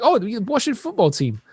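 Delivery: high-pitched mock British; British accent. Imitating a British person naming an American team, mock British accent. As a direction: put on a British voice, pitched well up. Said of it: "if I talk to a British person".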